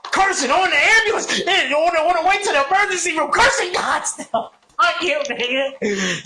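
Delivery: high-pitched heated rant; angry cursing. Retold or imitated. Retelling cursing God in the ambulance, heated. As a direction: throw the curse up at the sky. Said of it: "just cursing God".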